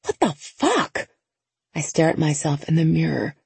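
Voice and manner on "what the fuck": high, slow disbelieving exclamation; panicked disbelief, drawn out; freak-out. Acted. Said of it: "quick little freak-out session".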